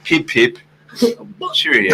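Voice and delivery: low, soft mock British accent. Co-host joining the accent game, mock British. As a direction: toss it off in a put-on British accent. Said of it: "I'm doing a British accent".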